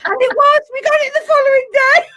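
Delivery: high, loud, laugh-soaked punchline; shrieking with laughter at the payoff. Real, not acted. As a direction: crack up as you deliver the punchline. Punchline of funny story.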